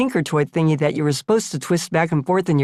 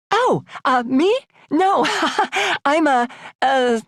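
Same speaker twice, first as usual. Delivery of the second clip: high, flustered stammer with a nervous laugh; 10 semitones higher than usual. Acted. Teenage girl flustered by the maitre d', high nervous stammer. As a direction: stumble over every word, caught off guard. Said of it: "He ignored my stammering".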